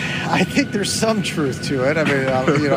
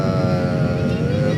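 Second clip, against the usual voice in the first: drawn-out low uh; long pilot uh; 8 semitones lower than usual. Shown as a demonstration. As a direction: stretch the uh out, low. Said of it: "That was long".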